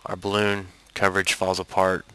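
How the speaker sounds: ordinary talk, nothing marked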